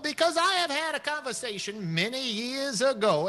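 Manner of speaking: a lightly voiced courtly old man; genteel old gentleman. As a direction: talk like a fussy, kindly old gent. Bartimus reminisces.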